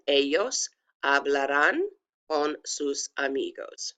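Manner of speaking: slow Spanish, "Ellos" with a y sound; pronunciation demo. Shown as a demonstration. Teacher repeating for students, slow careful demo. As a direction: say "Ellos hablarán con sus amigos" slowly, h left silent. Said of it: "pronounce them for you".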